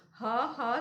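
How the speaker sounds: "ha, ha" as two short h-uh phonics; H sound. Shown as a demonstration. Teacher showing what he and house share, H sound. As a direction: sound out "ha, ha" separately, without laughing. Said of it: "sound is repeated".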